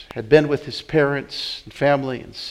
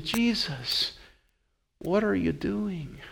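hushed reproach; 4 semitones higher, 10 dB quieter, slower than usual. Retold or imitated. Parents confronting Jesus.

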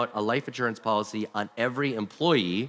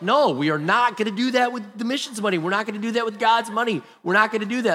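higher and louder, each no hammered; flatly refuse, getting more insistent; emphatic loud refusal; 9 semitones higher, 4 dB louder than usual. Retold or imitated. Pastor rejecting the man's plan: emphatic raised refusal. Repeated refusal, rising intensity.

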